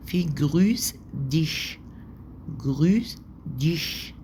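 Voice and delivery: slow German "جروس ديش" with the sch hissed; German demo. Shown as a demonstration. Teacher modelling "جروس ديش" for students, slow and clear. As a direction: say "جروس ديش" slowly, hissing the final sh. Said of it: "ديش دي اللي س".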